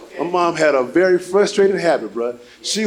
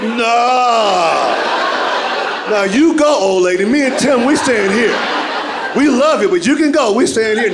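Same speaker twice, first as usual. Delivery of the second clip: emphatic refusal; 6 semitones higher than usual. Retold or imitated. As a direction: push back at the old lady. Refusing mom, raised voice.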